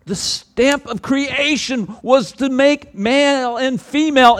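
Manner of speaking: loud, high, slow preaching; fervent sermon climax, loud and slow; preaching at full force. Acted. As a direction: proclaim it to the whole church.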